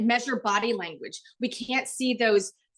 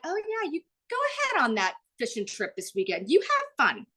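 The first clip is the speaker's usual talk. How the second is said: fake-breezy go-ahead with a pointed higher ending; passive-aggressive permission; 6 semitones higher than usual. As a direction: say have fun, meaning the opposite. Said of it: "vocal tone are telling you absolutely things are not fine".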